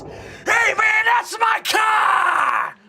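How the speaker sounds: high, slow, loud yell of outrage; angry shout. Acted. Johnny yelling at a teammate who wrecked his car, loud and high. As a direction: bellow that it's his car.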